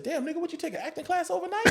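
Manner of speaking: high, soft, quick question; hushed amazement. Retold or imitated. Older actor walking over after rehearsal, quietly amazed. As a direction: marvel under your breath in a high pitch. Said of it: "He just said just like that".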